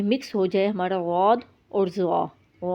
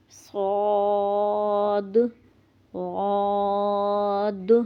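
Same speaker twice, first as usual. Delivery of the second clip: each letter drawn out slowly, one by one; reciting the alphabet; much slower than usual. Shown as a demonstration. Teacher reciting letters for her students, slow and drawn out.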